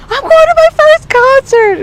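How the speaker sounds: high, slow squeal of excitement; thrilled kid shouting; excited. Retold or imitated. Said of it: "And I'm like".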